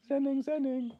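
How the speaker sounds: very high, playful little chant; singsong parody of blissful calm; mock zen. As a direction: pipe it up high like a pretend zen mantra. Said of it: "extra zen".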